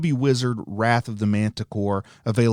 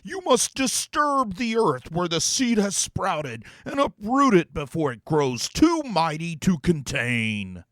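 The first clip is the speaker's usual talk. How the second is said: lofty, higher demon voice with odd timbre; 5 semitones higher than usual. Acted. Demon to the heroes, pronouncing a dramatic prophecy. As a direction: intone it like a grand, theatrical demon.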